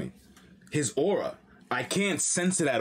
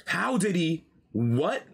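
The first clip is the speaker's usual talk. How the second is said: ordinary talk, nothing marked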